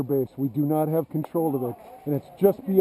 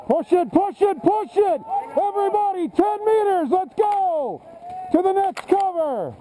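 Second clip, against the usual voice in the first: very high, strained yelling orders; shouting commands; 13 semitones higher than usual. Real, not acted. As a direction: drive the squad on, yelling. Ordering a push forward.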